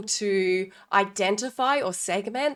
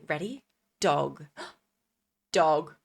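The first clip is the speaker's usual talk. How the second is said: clear syllable demo; 4 semitones lower than usual. Shown as a demonstration. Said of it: "So you go".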